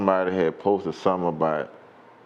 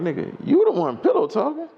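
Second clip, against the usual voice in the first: very high, indignant retort; incredulous comeback. Retold or imitated. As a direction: throw the accusation back in high-pitched disbelief. Retells his incredulous comeback to accusation.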